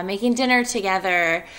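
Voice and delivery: ordinary delivery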